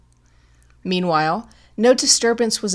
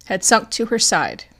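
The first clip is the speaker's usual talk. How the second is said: ordinary delivery, nothing marked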